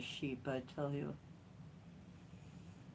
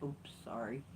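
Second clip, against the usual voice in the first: softer, lower sheepish little apology; sheepish cat voice; 4 semitones lower, 10 dB quieter than usual. Retold or imitated. Owner voicing her cat apologizing softly after a scratch. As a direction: mumble it like a caught kitty. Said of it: "he's like".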